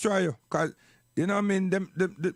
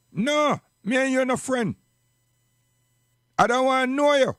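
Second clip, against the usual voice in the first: higher and louder, flatly refusing him; angry rejection; 6 semitones higher, 3 dB louder than usual. Real, not acted. Speaker rejecting the murderous politician, raised angry voice. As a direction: cut him off hard, no friendship.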